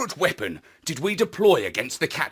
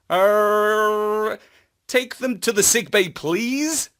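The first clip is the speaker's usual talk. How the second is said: higher, hesitant pleading request; lightly voiced Marlowe, coaxing; 5 semitones higher, slower than usual. A narrator's reading. Marlowe coaxing the robo-dogs, hesitant and pleading. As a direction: coax the dogs a little higher, unsure.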